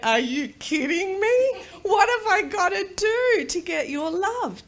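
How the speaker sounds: high, loud, hurt outburst; exasperated protest. Retold or imitated. Heartbroken child's exasperated inner protest.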